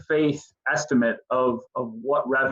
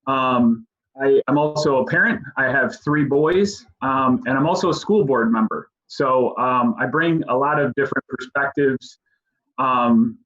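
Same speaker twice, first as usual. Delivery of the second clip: ordinary talk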